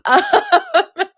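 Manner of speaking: high laugh after an apology; self-conscious laugh. Real, not acted. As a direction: laugh off the oversharing. Laughs after trauma dump apology.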